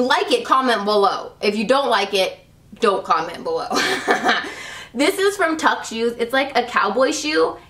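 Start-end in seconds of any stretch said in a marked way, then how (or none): 3.7-4.9 short breathy giggle; laugh. Real, not acted. Vlogger amused after telling haters not to comment, giggle. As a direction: giggle at your own joke.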